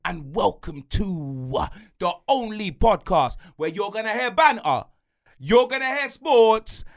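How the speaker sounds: slow, shouted wrestling-announcer build; announcer welcome. Acted. Host opens the episode like a ring announcer. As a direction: stretch out oozing sauce like a ring announcer.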